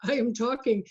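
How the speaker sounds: normal talking voice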